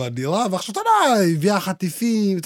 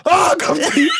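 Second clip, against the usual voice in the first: laughing; 8 semitones higher, 3 dB louder, slower than usual. Real, not acted. Laughing while retelling the scare.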